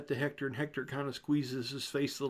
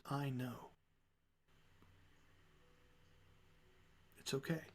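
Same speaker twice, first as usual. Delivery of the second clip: hushed comfort; 13 dB quieter than usual. Acted. She leans in close.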